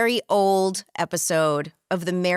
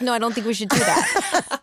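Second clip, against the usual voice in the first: laughing refusal; 4 semitones higher than usual. Retold or imitated. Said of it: "And I was like".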